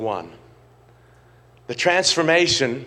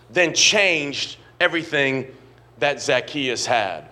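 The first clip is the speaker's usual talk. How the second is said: slow emphasis; slower than usual. Acted. Slow emphatic sermon point.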